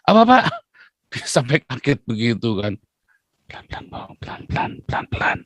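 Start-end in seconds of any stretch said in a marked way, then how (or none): 0.1-0.4 high, sudden raised-voice question; imitating a loud, puzzled employee; loud talker. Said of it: "ngomong banter". 3.5-5.4 hushed, unvoiced whisper; barely audible plea to keep it down; whispering boss. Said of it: "kalau ngomong kayak bisik-bisik".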